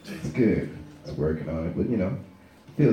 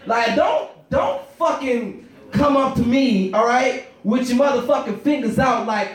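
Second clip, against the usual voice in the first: normal talking voice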